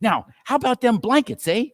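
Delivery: put-on sly old thief. Thief gloating over stolen goods.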